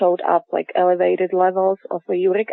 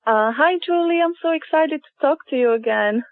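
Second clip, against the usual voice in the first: over the phone; 8 semitones higher than usual. Real, not acted. Caller on the line.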